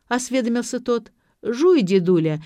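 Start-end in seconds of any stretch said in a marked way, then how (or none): none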